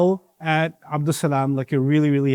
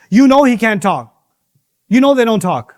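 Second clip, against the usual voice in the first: louder, higher indignant retort; mock indignant crowd; 5 semitones higher, 5 dB louder than usual. The crowd retorting to Ibrahim, mildly indignant. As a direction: snap back as the offended crowd.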